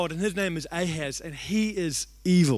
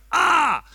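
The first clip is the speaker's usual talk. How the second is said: high, loud yelp of pain; pained cry; 9 semitones higher, 6 dB louder than usual. Retold or imitated. Punched in the chest during prayer, high pained yelp. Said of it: "And I go".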